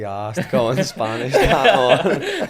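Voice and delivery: words breaking into laughter; laughing. Real, not acted. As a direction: laugh through the line. Laughs while retelling mate's line.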